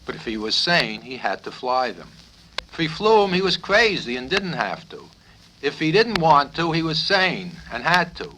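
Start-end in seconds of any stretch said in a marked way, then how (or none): 2.7-8.3 exasperated. A narrator's reading. Narrator building the paradox.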